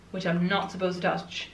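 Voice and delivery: ordinary delivery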